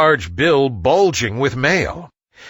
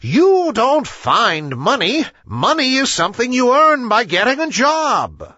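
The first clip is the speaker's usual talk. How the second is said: exasperated owl; 10 semitones higher than usual. A narrator's reading. Owl explains money to ignorant animals, higher and impatient. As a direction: puff up and hoot the obvious at them. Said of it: "he hooted".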